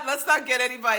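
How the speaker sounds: high, breaking up laughing while talking; real amused laughter. Real, not acted. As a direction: try to speak through a fit of laughter. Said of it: "that's so funny".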